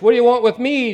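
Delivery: loud high demonic shout; a possessed man screaming at Jesus. Acted. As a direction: shout it from the top of your voice. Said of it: "He shouted at the top of his voice".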